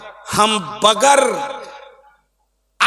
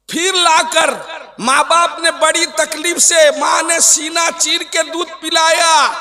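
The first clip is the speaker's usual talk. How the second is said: higher-pitched, declaimed sermon; preaching; 7 semitones higher than usual. Acted. Preacher honouring parents' hardship, impassioned preaching. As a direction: proclaim it to the crowd in a sustained raised voice.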